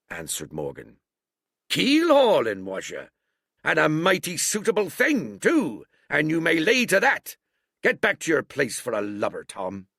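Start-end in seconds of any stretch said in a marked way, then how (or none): 1.7-9.9 high, loud scornful scolding; scolding old sea cook. A narrator's reading. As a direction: send the lubber off with contempt. Silver scolding Morgan.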